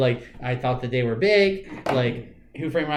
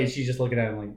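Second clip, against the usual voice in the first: ordinary talk, nothing marked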